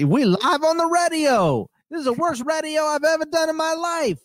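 imitating the angry host. Retelling the Houston host yelling on air, shrill mock impression. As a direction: screech it like a host melting down on air. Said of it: "saying radio instead of radio".

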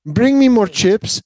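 high, slow, haughty commanding voice; rude rich customer. Retold or imitated. Rich Spaniard ordering waiter around, high haughty drawl. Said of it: "they'd be like".

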